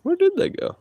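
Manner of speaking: sudden high bewildered question; high squeak of confusion; surprised. Real, not acted. Lost the animals.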